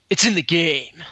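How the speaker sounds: punchy imitation of a game ad tagline; mock announcer's tagline inflection; slogan inflection. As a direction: deliver it like a sports game slogan. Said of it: "How do you say that?".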